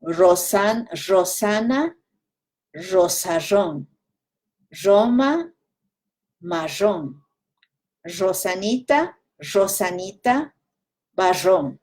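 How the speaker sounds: Rosarón said as Rosaʒon, R turned to a zh sound; Marrón with a buzzing zh for the R, Ecuadorian highland style; Ecuadorian Andes R. Shown as a demonstration. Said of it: "Andes way once".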